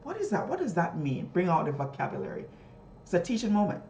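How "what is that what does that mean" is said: high, curious question; teacher asking pupils, pitched up; questioning the class. Shown as a demonstration. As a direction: prompt pupils to think. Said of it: "I want to say".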